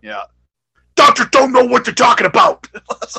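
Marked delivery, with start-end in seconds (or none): 0.9-2.7 full-throated, high-pitched mock promo yell; Animal's yelled promo. Host imitating a wrestler's promo style, yelling. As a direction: bellow it like a wrestler cutting a promo. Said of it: "and he yells, right? He just yells".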